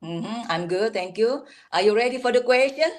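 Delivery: usual speaking voice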